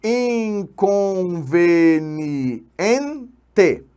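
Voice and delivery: Inconveniente very slowly, syllable by syllable, leaning on EN; stressed syllable demo. Shown as a demonstration. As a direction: stretch Inconveniente out to show the stressed next-to-last syllable. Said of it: "sílaba tônica colocada na penúltima".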